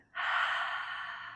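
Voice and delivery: long breathy sigh out the mouth; audible exhale sigh. Shown as a demonstration. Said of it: "out through mouth with a sigh".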